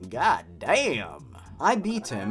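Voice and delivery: normal talking voice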